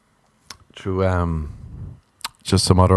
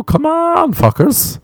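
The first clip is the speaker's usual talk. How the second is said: exasperated outburst; slower than usual. Real, not acted. Exasperated at hangout invites.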